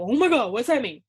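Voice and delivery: loud mock panic, imitating a lost student; panicked student. Teacher imitating a confused student hearing iambic pentameter, mock panic. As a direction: blurt it out like a student lost in the lesson. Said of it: "You go".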